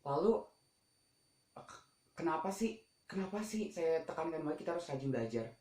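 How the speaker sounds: ordinary talk, nothing marked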